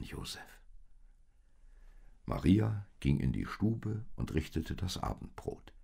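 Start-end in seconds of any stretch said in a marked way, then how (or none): 0.0-0.6 whispered tenderly; whispered name. Acted. Maria softly whispers Josef's name to end her reassurance. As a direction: breathe his name softly.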